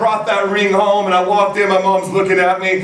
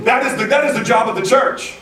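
raised, driving sermon delivery; preaching; 5 semitones higher than usual. Acted. Pastor calling the congregation to listen to God, preaching. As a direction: press the point home to the church.

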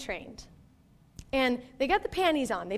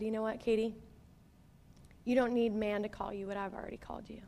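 gentle voice of God; 3 semitones lower, 8 dB quieter, faster than usual. Retold or imitated. God speaking in a prayer memory.

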